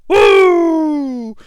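long, high-pitched mock whoop; imitated yell. Imitating a masked attacker fleeing up the ramp, high yell. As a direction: let out a drawn-out "woo". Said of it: "runs up the ramp yelling".

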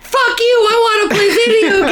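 high, fast yelling brat through laughter; squealing kid tantrum, laughing; bratty kid shout. Pretend. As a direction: throw a shrieking tantrum like a spoiled kid. Voicing bratty kid Sonny.

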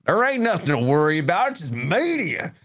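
high-pitched caricature of a scoffing local; mock dismissive local. Mimics dismissive locals against cautious ones.

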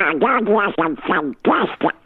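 squawky cartoon duck talk; Donald Duck voice. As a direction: keep quacking like Donald.

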